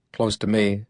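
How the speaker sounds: plain reading voice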